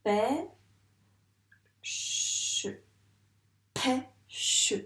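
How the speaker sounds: "Pêche" broken as pê, long pause, che; syllable by syllable. Shown as a demonstration. Teacher showing the two syllables, "Pêche" pulled apart. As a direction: leave a clear gap between "pê" and "che" in "Pêche". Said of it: "J'ai donc deux syllabes".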